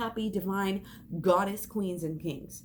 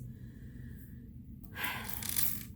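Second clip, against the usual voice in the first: sigh; 14 dB quieter than usual. Real, not acted. Sigh after apologising.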